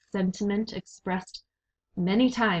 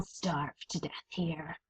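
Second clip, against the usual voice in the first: menacing whisper; 3 semitones lower, 9 dB quieter than usual. Acted. Rat threatens in whisper.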